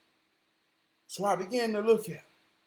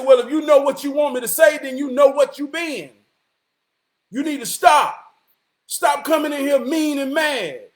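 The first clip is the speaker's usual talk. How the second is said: loud, raised-pitch telling-off; retold confrontation; 4 semitones higher, 8 dB louder than usual. Man telling the woman to stop being mean, scolding. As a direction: push back hard and loud.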